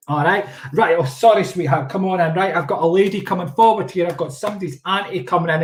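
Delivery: ordinary talk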